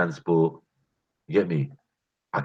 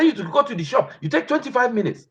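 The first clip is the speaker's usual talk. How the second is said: mum scolding. Retold or imitated. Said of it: "My mum be mad".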